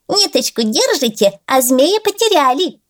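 high teasing frog voice; mock them like a giggly frog. Frog mocks them laughing.

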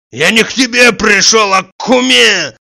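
loud, high protest; indignant yell. A narrator's reading. Tarantiev insisting he visits the hostess, high indignant yell. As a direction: yell it back defiantly. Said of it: "вопил Тарантьев".

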